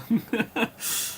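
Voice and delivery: soft, high giggling laugh; quiet run of giggles; amused laugh. Real, not acted. Commentator amused by his server remark, giggling.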